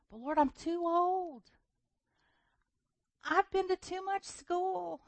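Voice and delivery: high, slow whining plea; pleading protest. Retold or imitated. Preacher arguing with God, high and slow. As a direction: beg off like a reluctant child. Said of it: "And I said".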